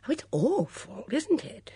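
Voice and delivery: very high, fluty chatter; conversational self-parody. A narrator's reading. Missus Swettenham making a great effort, conversationally. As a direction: make an effort at light small talk. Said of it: "she said conversationally".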